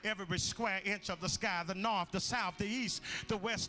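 loud, rising revival preaching; preaching at full force. Acted.

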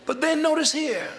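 high-pitched pulpit call for attention; emphatic preaching. Real, not acted.

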